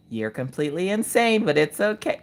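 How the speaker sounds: soft, careful reassurance; imitating a gentle calming friend. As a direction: soothe quietly, humoring them. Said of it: "she tries to calm me down".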